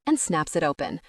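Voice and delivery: ordinary delivery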